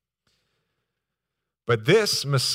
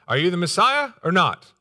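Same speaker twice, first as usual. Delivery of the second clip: higher, louder, demanding question; pointed question; 7 semitones higher, 7 dB louder than usual. Acted. John questioning Jesus, pointed and direct. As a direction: press for a straight answer. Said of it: "John asks a question".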